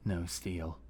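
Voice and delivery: ordinary delivery, nothing marked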